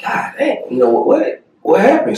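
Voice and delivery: high, hushed, half-whispered shock; stunned disbelief. Retold or imitated. Realising his foot gave out, high hushed confusion. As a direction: catch your breath in confusion. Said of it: "I'm like".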